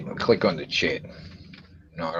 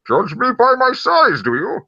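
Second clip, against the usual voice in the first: a botched Bane that comes out as a creature; Yoda-sounding Bane; 5 dB louder than usual. Acted. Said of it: "doing a Bane voice, but it just sounded like Yoda".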